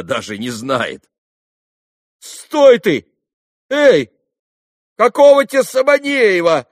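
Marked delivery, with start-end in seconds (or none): 2.2-6.7 very high, loud yells; agitated calling. A narrator's reading. Lad calling the departing boy back, agitated shouting. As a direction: holler after someone, all worked up. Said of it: "весь опять заволновавшись".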